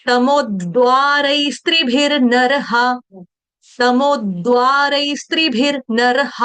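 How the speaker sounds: तमोद्वारैस्त्रिभिर्नरः with half and full र kept apart, slowly; half r sounds. Shown as a demonstration. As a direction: sing each र as written. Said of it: "र आधा है वहां आधा". (आधा र आधा, पूरा र पूरा)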